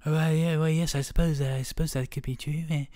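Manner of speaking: hesitant British nerd. Shown as a demonstration. Friend showing off his voices, nerdy British accent. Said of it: "That's my nerd British voice".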